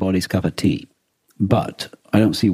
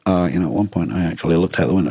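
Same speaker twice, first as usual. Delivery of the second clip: usual speaking voice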